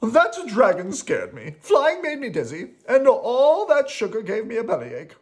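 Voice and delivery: high, loud upset complaint; distressed character wail, no real tears; crying out. Acted. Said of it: "Rocky cried".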